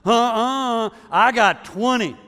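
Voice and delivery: put-on high, loud kid insisting "I got twenty"; indignant little boy. Johnny insists to his brother, high and loud. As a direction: play the stubborn kid digging in. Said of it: "you can hear this conversation".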